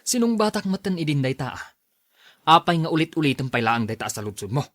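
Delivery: rising irritation that bursts into a loud shout; angry husband. Acted. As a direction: snap back at the nagging, then blow up. Husband snaps at wife's nagging. (nagpungtot a nagriaw)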